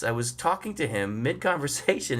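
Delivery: normal talking voice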